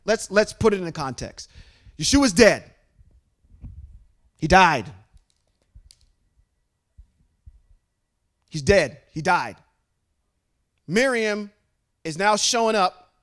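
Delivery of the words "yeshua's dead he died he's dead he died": emphatic. Acted. Preacher stressing the death.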